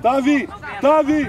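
high, loud, drawn-out name call. Real, not acted. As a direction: call the player twice, slowly and loudly. Coach calls out to player. (strigăt prelung, tare)

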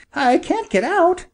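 small, high storybook bird voice; little bird. Acted. Little bird thinks aloud.

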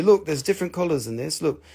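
usual speaking voice

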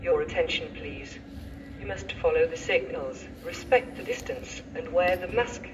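PA loudspeaker announcement voice; echoing station tannoy; train station announcement. Real, not acted.

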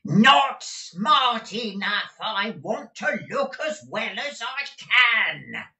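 very high, slow, full shout; roaring. A narrator's reading. Exasperated Gride roaring at his deaf housekeeper. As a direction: roar it into a deaf ear. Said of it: "as he roared in her ear".

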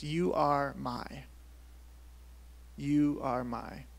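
very slow, each word set apart; measured, weighty repetition; slow, deliberate. Shown as a demonstration. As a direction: spell out "You are my" word by word. Isolates the phrase for emphasis.

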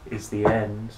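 plain reading voice

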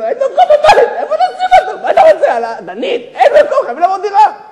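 very high, hammed-up screaming complaint; frantic wife. Wife to husband after fifth child, frantic screaming. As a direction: screech it as a wife at her limit.

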